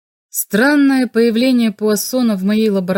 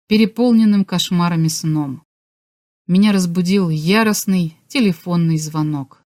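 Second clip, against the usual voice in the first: ordinary delivery, nothing marked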